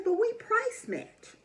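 lightly voiced store clerk; slow, high clerk voice. Quoting the clerk.

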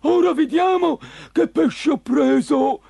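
high, loud character voice; eager monster fisherman. Acted. Green fisherman sorting through his net, eager and loud. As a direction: relish the catch, loud and high.

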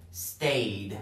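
"Stayed" as one syllable ending in d; "Stayed" low, with a voiced final d. Shown as a demonstration. Teacher giving igual example, "Stayed" closing on a plain d.

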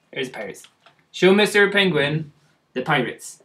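ordinary talk

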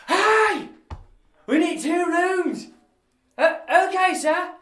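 high, loud shout for rooms; calling out. Acted.

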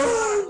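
very high "ay" moan; hammed-up high wail; mock group wail. Whole congregation at the altar, mock wail. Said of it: "Y acá todo el mundo".

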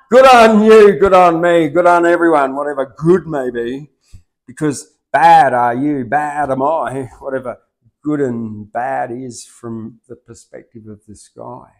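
loud, high declaimed poem reading; poem recitation. Acted. Poet restarting his poem for the host, declaimed. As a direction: proclaim each line with fervour.

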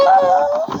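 high surprised shriek; a sudden high yelp of surprise; startled cry. Real, not acted.

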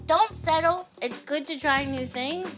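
ordinary talk, nothing marked

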